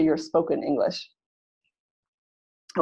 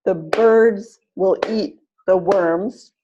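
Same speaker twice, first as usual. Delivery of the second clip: slow, BIRDS and WORMS punched on each beat; teacher modelling rhythm, slow and clear; stress-timed demo; 4 dB louder, slower than usual. Shown as a demonstration. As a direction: clap it out, one beat per stressed word. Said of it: "Line three".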